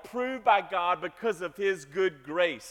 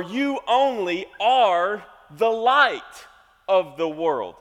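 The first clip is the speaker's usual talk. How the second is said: "You only are the light of the world" slow and louder, stress on "light"; slow it down and land on "light"; slow emphatic reading; 6 dB louder, much slower than usual. Shown as a demonstration. Pastor reading verse fourteen to the church, slow and weighty. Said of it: "skip down to verse fourteen".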